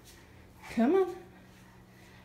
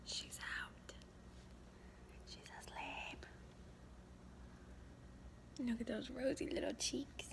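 whisper; 20 dB quieter than usual. Real, not acted. Mom whispering over her sleeping baby. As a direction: breathe the words without voice. Baby just fell asleep.

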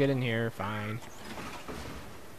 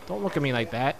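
usual speaking voice

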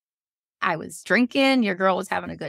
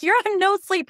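exasperated; 12 semitones higher than usual. Retold or imitated. Arguing with husband over hiking.

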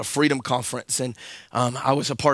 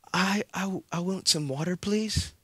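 put-on small child's request; 3 semitones higher, 7 dB quieter than usual. Child asking politely.

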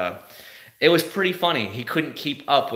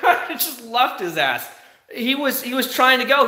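laughing; 10 semitones higher than usual. Real, not acted. Laughing after the taunt.

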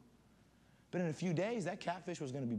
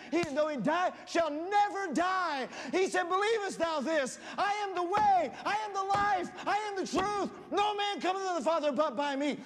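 much higher and louder, shouting the gospel; preaching at full force; 12 semitones higher, 8 dB louder than usual. Acted. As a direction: shout the gospel to the young people. Climax of the sermon.

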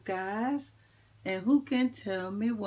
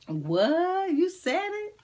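mock surprise; 10 semitones higher, 6 dB louder, slower than usual. Playful mock surprise at kids.

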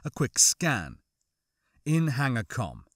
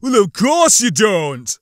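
slow, loud, raised, angry retort; growled; 6 semitones higher, 10 dB louder than usual. A narrator's reading. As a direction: growl it as an impatient commanding officer. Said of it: "Larone growled".

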